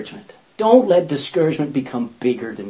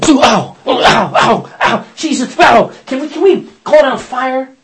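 mock pain cries; 8 semitones higher, 5 dB louder, slower than usual. Disciples hit by stones.

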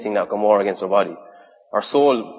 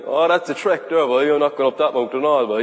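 mock rural Irish accent; 4 semitones higher than usual. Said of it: "He wouldn't be like".

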